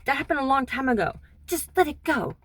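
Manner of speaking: mother snapping, pitch up high; angry mom. Retold or imitated. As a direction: mom angrily telling her daughter to let it go. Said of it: "My mom very angrily is like".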